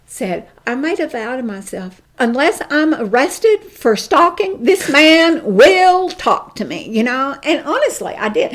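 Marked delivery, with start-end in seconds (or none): 2.2-6.9 loud, determined vow, slow at the end; fierce vow. Retold or imitated. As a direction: swear it with fury. Quoted vow, came unglued.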